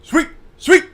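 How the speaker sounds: loud high call of sweep; sweepers yelling. Retold or imitated. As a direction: yell it like a teammate on the ice. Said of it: "and the sweepers go".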